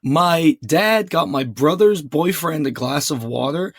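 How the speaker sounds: ordinary talk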